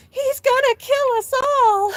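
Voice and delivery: high, drawn-out panicked scream; loud mock-terrified wail, very high; imitated scream. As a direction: scream it like a terrified little brother. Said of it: "He was screaming".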